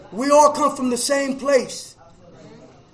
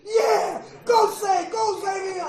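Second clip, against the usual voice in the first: retold cheering scream; 7 semitones higher, much slower than usual. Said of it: "I be up standing up screaming".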